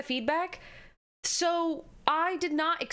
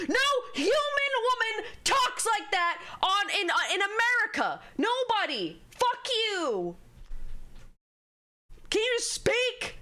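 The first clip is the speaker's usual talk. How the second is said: exasperated rant; 8 semitones higher than usual. Real, not acted. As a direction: rant it in real exasperation, pitched high. Furious rant after mocking dub girl.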